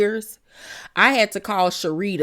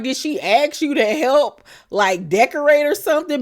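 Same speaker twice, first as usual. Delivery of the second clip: incredulous question; 6 semitones higher than usual. Retold or imitated. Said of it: "I was like".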